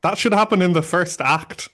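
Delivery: really worked up, arguing the point; indignant outburst in a friendly argument; shouting. Real, not acted. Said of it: "Sorry, I'm shouting".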